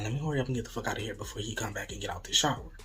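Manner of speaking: quick, low, soft self-talk; hurried. Retold or imitated. Storyteller re-enacts hurrying out before he returns, hushed and rushed. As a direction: rush it under your breath. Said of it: "real fast".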